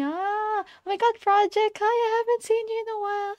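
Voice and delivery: high, bright burst of delight at a familiar name; excited greeting. Real, not acted. Streamer greets Project after a long while, excited high voice.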